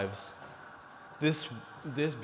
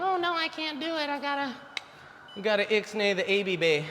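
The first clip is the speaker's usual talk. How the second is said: high mocking falsetto of a woman backing out; mocking whiny woman; 10 semitones higher than usual. Mocking women who won't keep their babies, in high falsetto. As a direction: squeak it like a woman who can't cope. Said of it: "all you hear is women saying".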